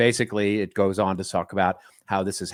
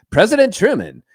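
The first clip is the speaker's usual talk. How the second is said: high, loud, proclaiming newsreel voice; 1930s newsreel announcer; 7 semitones higher, 8 dB louder than usual. Acted. Host showing co-host how these stories feel, as a newsreel announcer. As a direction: announce it like a vintage cinema bulletin. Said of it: "those nineteen thirties newsreels".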